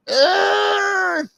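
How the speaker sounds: long, squealed groan; high-pitched wail. Acted. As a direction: let out an exaggerated high cry. Acted outburst in a skit.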